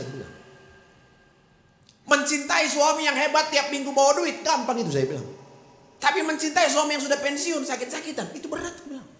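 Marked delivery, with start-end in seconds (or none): 2.0-8.8 high, raised preaching voice; fiery preaching. Acted. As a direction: preach it up to the crowd. Sermon quote, fiery preaching.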